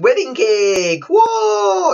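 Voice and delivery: slow announcement rising to a high, loud whoa; acted wide-eyed amazement; amazed crowd. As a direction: draw it out, then shout in awe. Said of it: "Everyone is so surprised".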